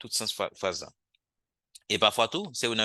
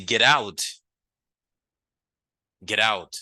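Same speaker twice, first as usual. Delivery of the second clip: shouted command; 7 dB louder, slower than usual. Shown as a demonstration. Teacher acting out a command.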